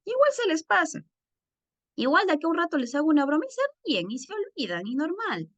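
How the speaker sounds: put-on high sing-song kids brushing it off; whiny, carefree child impression; mocking children's voices. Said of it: "Entonces mis hijos dicen".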